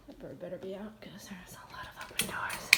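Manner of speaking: hushed muttered whisper; anxious whisper. Real, not acted. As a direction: whisper to yourself nervously. Whispering to herself.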